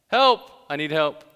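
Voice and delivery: high, loud cry for help; calling for help. Acted. Preacher acting out a plea to his helpers, calling for help. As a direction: call out loudly as if pleading for rescue.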